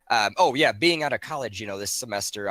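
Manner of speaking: ordinary delivery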